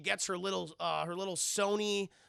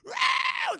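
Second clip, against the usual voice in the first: very high, loud feral screech; zombie screech; 9 dB louder than usual. Acted. Mind-controlled Claire screeching at Alice, very high and loud.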